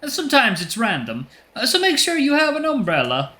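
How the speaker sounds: high, cartoonish Italian accent. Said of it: "stereotypical Italian voice".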